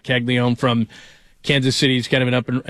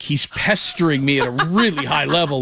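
ordinary talk, nothing marked

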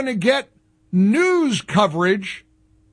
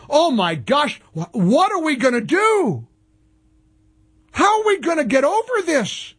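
higher-pitched, sarcastic hand-wringing; mock dismay; 6 semitones higher than usual. Host mocking a network boycott, sarcastic dismay. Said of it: "capture my sarcasm".

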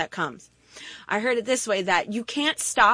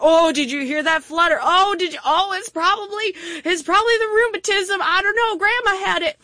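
put-on higher, fussy worried old voice; mock hypochondriac panic; 6 semitones higher than usual. Preacher mocks a worried believer, higher anxious fretting. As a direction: fret over every flutter of the heart.

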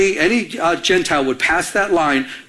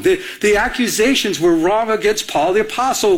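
normal talking voice

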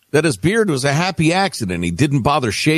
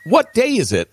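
Pooh voice; 9 semitones higher than usual. Acted. Reading Pooh quote.

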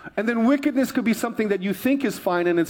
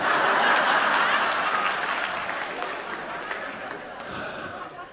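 a real airy laugh rolling on; quiet unvoiced laughter fading; long breathy laugh; 4 dB quieter than usual. Real, not acted. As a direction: laugh off your own punchline. Laughs after punchline.

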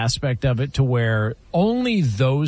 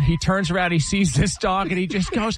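ordinary delivery

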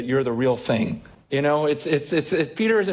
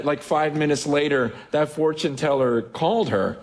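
ordinary delivery

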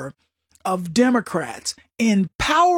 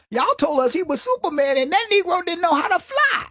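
higher, punchy comic delivery of the line; Cat Williams impression; 8 semitones higher than usual. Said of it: "He said".

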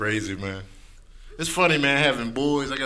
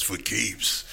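low, slow trailer announcer; low slow epic trailer read; movie trailer voice; 6 semitones lower, much slower than usual. Shown as a demonstration. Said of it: "sound like movie trailer terms".

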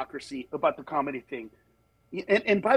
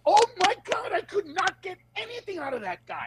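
very high, hammed-up disbelief; mock exasperation; 14 semitones higher than usual. Next comedian gets off stage defeated, in high mock exasperation.

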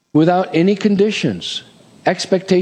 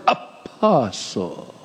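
"apostle" stretched very slow, hushed and grand; emphasis on APOSTLE; 5 semitones lower, 5 dB quieter, much slower than usual. Shown as a demonstration. Preacher showing the congregation a self-important title, drawn-out emphasis on "apostle". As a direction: draw out each syllable of "apostle" pompously. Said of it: "put emphasis on that word".

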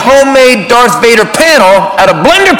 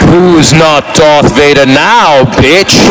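lower, slow menacing gloat; deep slow Vader gloat; 7 semitones lower, much slower than usual. Retold or imitated. Vader gloating at the fallen older brother, slow and low. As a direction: loom over him as Vader. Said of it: "I was in character".

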